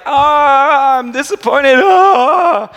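high, loud mock whimper; whiny soul. Preacher voicing his own sad soul, mock whimpering. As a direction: whine like a sulky child. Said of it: "So if my soul is like".

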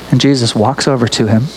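usual speaking voice

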